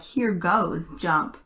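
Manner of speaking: ordinary delivery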